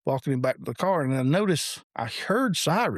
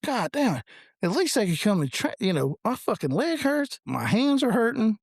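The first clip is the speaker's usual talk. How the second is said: higher-pitched pissed-off griping; retold annoyance; 8 semitones higher than usual. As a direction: grumble in a raised pitch, retelling your irritation. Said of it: "like, pissed".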